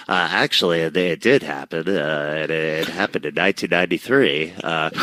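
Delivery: know-it-all nerd correcting you; lightly voiced pedantic nerd. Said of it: "I'm gonna use the nerd voice here".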